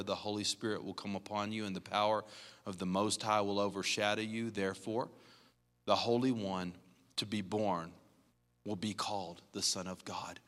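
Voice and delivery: soft, reverent reading of the verse; solemn scripture reading. Acted. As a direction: read the angel's promise softly and reverently. Reads the angel's words.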